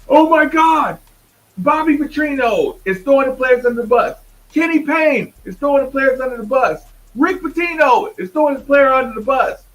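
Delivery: hammed-up pundits crying foul; mocking the pundits. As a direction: parody the hysterical media. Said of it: "you know what the coaches do".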